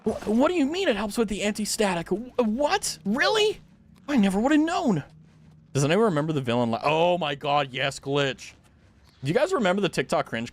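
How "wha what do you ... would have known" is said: mock amazement. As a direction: act stunned by something obvious. Mock amazement at dryer sheets.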